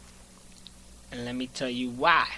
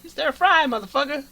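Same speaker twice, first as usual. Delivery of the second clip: high, punched comic catchphrase; Samuel L. Jackson; 12 semitones higher, 6 dB louder than usual. Acted. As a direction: hit the first words loud, like a movie tough guy. Riffing on actor's catchphrase.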